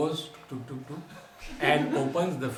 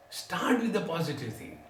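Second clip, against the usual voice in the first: ordinary delivery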